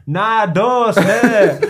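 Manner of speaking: high, loud mock northern shout; hammed-up northern outburst; loud northern accent. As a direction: imitate a fuming mate's broad northern yell. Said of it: "he said it so loud".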